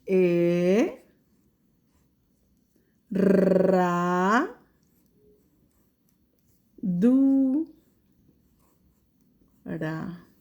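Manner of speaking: "E, ra, du, ra" with long pauses between; teacher splits "herradura" into syllables. Shown as a demonstration. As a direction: spell it out in beats. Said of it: "vamos a escribirla".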